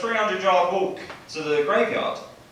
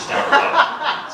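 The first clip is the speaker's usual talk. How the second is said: high, louder, laughing breathlessly; 12 semitones higher, 7 dB louder than usual. Real, not acted. Comic listing unanswered texts to the crowd, laughing. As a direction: crack up on the last word. Punchline of texting list.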